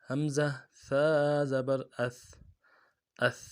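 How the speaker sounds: اث begun with a sharp catch, the ث let out softly and held; soft tha drill. Shown as a demonstration. Teacher showing students: soft tha, continued sound. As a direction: read اث very slowly, with a firm start and a soft, sustained ث. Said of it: "ثا کو نرمی سے پڑھنا ہے".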